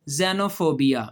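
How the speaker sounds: xenophobia slow, with a z start; slow pronunciation. Shown as a demonstration. Teacher repeating the answer slowly for children. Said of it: "क्या कहते हैं".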